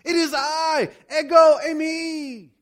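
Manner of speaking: loud, high shout with stretched ending; yelling. Acted. Jesus declaring himself over the wind, yelling. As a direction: shout it slowly across a storm. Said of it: "yelling this at the top of his lungs".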